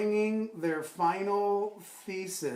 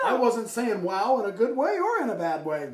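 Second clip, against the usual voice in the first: high, loud refusal in a put-on voice; hammed-up evasive protest; Tom Henry; 6 semitones higher, 7 dB louder than usual. Tom refusing to explain his wow, high hammed-up voice. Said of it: "working on my Tom Henry impression".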